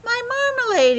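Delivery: high, loud cry of relief; thankful exclamation. A narrator's reading. Bear finds his lost marmalade, loud happy exclaim. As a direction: shout it out in glad relief. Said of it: "he exclaimed thankfully".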